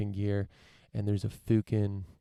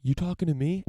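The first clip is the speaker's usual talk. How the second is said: tough guy; 7 semitones higher, 3 dB louder than usual. Retold or imitated. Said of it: "the guy's like".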